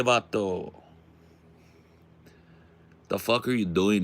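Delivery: put-on tough-guy Chicano street accent. Warning someone off his turf, put-on Chicano accent. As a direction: play a menacing vato with a Chicano accent.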